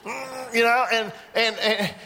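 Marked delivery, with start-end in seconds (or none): 0.0-0.5 high, quiet straining grunt; mock effortful "mm"; constipated straining. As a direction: squeeze out a pretend strain. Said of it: "look constipated".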